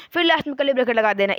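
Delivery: ordinary delivery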